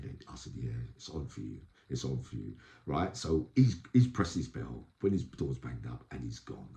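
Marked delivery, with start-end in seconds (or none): 0.6-2.5 soft, low, grave tip-off; hushed warning. Retold or imitated. Telling the man the others are coming for him, hushed. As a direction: lean in and say it under your breath.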